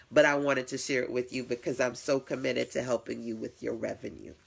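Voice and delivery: normal talking voice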